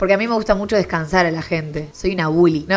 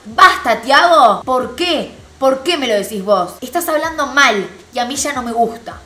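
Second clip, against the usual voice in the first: raised, higher-pitched acted protest; indignant retort; 5 semitones higher, 6 dB louder than usual. [name] answering back to Tiago's shushing, acted indignant protest. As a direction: imitate someone fed up, starting loud and high.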